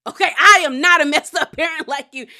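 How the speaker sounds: loud, high mock outburst breaking into laughter; heated retold comeback. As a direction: belt it out as an imitated put-down. Retold angry comeback in a quarrel.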